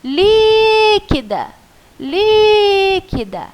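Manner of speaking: loud, high call with a long-held "lí". Shown as a demonstration. Teacher at the word's house gate calling it by name, calling out. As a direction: call the word as if summoning someone from the gate. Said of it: "vou chamar ela".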